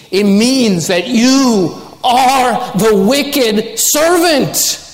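high, slow, emphatic sermon warning; impassioned, high-pitched exhortation from a preacher; full-force preaching. Real, not acted. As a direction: preach it with urgency, drawing out key words.

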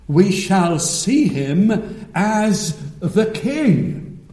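slow, stately pulpit proclamation; fervent preaching. Real, not acted. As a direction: ring out each royal title, drawn out and building in force. Preaching the coming King.

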